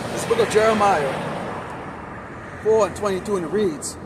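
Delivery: forceful reading, hitting the key phrases hard; preached scripture reading. Real, not acted. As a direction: declaim the verse to the street.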